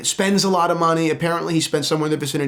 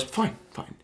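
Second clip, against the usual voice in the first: breathy giving in; 5 semitones higher, 10 dB quieter than usual. Retold or imitated. Giving in reluctantly.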